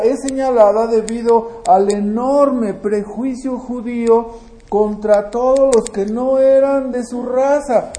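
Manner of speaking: normal talking voice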